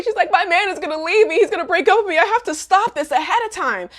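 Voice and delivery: high, urgent retold fretting; hammed-up alarm; mock panic. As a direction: imitate a girl dreading a breakup. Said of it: "So Agatha had a nightmare".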